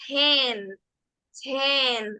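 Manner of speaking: ten, with a puff of air after the T; aspirated English T. Shown as a demonstration. Teacher modelling for students, aspirated ten. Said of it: "la T tiene más aire".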